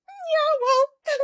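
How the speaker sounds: very high, loud little squeak; mock squeaky concession; squeaky reply. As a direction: act out a reply in a tiny high voice. Own sheepish reply acted out.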